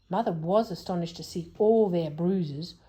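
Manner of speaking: usual speaking voice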